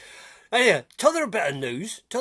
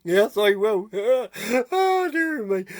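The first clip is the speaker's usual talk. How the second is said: chuckling; 3 semitones higher, 3 dB louder, much slower than usual. Acted. Laughing at his own joke.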